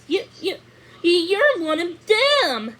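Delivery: put-on astonished girl, voice jumping up; stunned. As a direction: stammer it out in amazement. Thumbelina stunned at prince.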